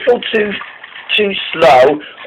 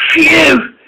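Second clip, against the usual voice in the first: high "phew" of relief; relieved; 12 semitones higher than usual. A narrator's reading. Caitlin has finally stopped safely, relieved.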